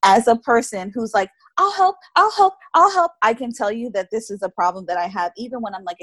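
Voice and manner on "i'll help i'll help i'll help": high, eager mock volunteer; eager helper voice. Podcaster mocking her own eager helper self. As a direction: chirp it like an overeager helper.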